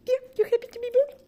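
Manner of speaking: very high, fast squeaky coo. Real, not acted. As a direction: gush at the puppy in a high rush. Cooing to dog again.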